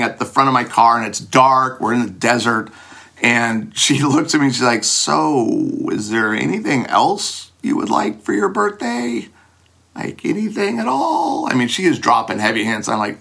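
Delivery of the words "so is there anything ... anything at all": higher, softer teasing hint; coy hint. Retold or imitated. Girlfriend fishing for a birthday kiss, higher and softer.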